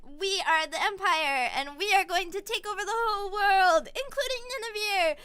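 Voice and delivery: hammed-up empire speech; villainous proclamation. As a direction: announce world conquest, building in pitch. Invading empire's proclamation, acted.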